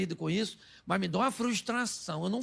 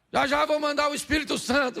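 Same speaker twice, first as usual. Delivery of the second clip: high, sustained preaching call; acted preaching Jesus; 6 semitones higher, 3 dB louder than usual. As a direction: proclaim it as a preacher, pitched up. Acts Jesus preaching over Peter.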